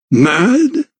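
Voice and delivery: high, rising affronted question; offended, pitch sweeping up; indignant echo. A narrator's reading. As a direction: repeat it as if insulted. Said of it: "He seemed indignant".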